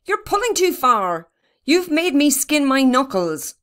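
high, hurt complaint; pained boy's complaint; crying out in protest. A narrator's reading. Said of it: "cried Barney".

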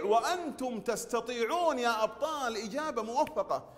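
ordinary talk, nothing marked

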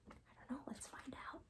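hushed whisper aside. Real, not acted. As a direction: share the suspense in a whisper.

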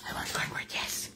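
breathy whisper of wanting; whispered craving. Real, not acted. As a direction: whisper it to yourself, longing for the cornbread. Craving cornbread, said to herself.

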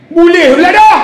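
jeritan garang, tinggi dan lantang; marah. Retold or imitated. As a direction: lakonkan pegawai menengking. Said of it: "Cik marah pakcik ni". (tengking dengan suara tinggi)

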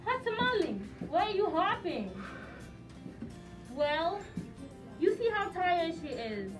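normal talking voice

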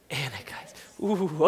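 very soft, low, then a shivery ooh; dreaded name. Shown as a demonstration. Preacher leading the room in the dreaded name, hushed. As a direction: lead them in a hushed shudder. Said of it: "Just say Anakites with me".

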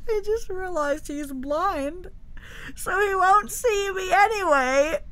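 high tearful laughing; laugh-crying. Real, not acted. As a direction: laugh through near tears.

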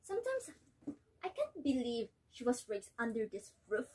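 worn-out mother, resigned and hurt; disappointed mother; weary mother. Acted. Nella recalls obedience, despairs of daughter.

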